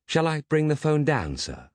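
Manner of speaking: high, polite machine question; polite robot butler. Acted. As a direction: inquire like a dutiful robot. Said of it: "inquired Canterbury".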